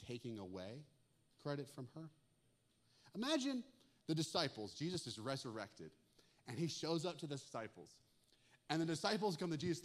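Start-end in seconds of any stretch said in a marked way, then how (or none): none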